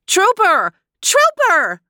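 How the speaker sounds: put-on very high children's shouts; squealing kids calling a dog; excited kids crying out. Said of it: "they cried".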